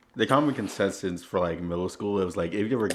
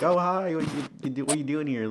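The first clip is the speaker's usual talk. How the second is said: higher-pitched mock girl voice; imitated girl's greeting; 7 semitones higher than usual. Girl greets him at after-school program, imitated surprise. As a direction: lightly play a girl surprised to see you.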